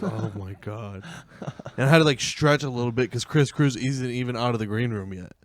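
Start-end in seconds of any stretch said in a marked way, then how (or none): 0.0-1.0 out of breath. Retold or imitated. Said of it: "I'm out of breath".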